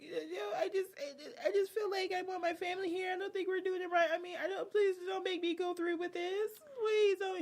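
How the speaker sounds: high, tearful pleading mock; mock sobbing bride. Bride at the altar begging not to go on, mock sobbing. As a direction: beg through fake tears. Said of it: "crying while she's".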